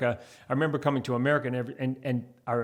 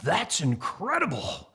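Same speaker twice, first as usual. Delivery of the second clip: amazed; 3 semitones higher, slower than usual. Retold or imitated. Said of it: "in America in the eighties".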